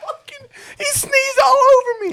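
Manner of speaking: high, loud disgusted yell; disgusted shout. Real, not acted. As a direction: shriek it in disgust.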